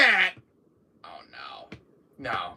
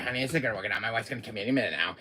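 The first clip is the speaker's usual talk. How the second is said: normal talking voice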